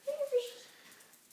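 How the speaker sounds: high, slow coaxing voice; drawn-out pet call; talking to fish. Pretend. Calling the dog as fish.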